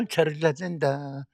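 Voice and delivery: soft, slow, listless encouragement; mock uninterested parent; half-hearted cheering. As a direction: cheer as if you barely care. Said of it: "이렇게 하는 부모가 없습니다".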